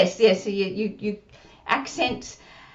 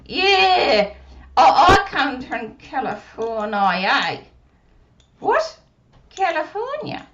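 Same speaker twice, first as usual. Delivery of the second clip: put-on puppet child voice; 2 semitones higher, 6 dB louder, slower than usual. Puppet Susie speaking.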